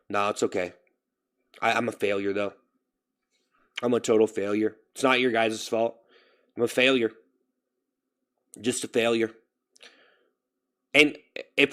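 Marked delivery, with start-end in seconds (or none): 0.1-9.3 dejected. Real, not acted. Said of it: "I feel like a failure".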